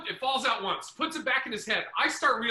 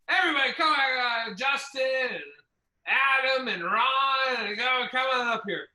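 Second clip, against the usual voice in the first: imitating drunk headliner; 5 semitones higher than usual. Imitating drunk headliner calling comics.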